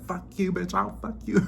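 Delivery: mock snapping nerd. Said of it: "snaps and starts calling people bitches".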